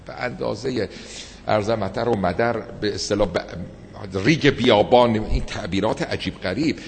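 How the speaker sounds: usual speaking voice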